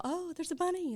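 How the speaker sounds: a lilting, high "oh" of pleased surprise; delighted crowd reaction. Retold or imitated. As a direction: coo it like charmed onlookers. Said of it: "they all".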